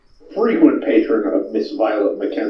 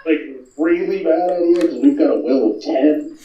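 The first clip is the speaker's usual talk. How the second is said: chuckling through the words; laughing; 3 semitones higher than usual. Real, not acted. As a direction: laugh while you talk. Laughing while narrating.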